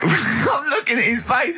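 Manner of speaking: laughing hard while talking; words squeezed out through laughter. Real, not acted. Host laughing at his cohost's grimace.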